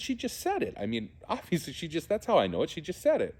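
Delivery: half-laughing high-voiced 'she just said it'; incredulous high reply.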